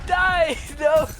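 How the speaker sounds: high, loud battle yell; shouting. Real, not acted. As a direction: yell it at the enemy. Shouting at enemy in game.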